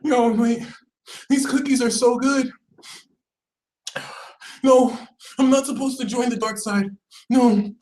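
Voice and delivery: high, whimpering lament; hammed-up tearful voice; mock crying. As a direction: wail as if torn by temptation. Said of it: "Sagittarius was crying".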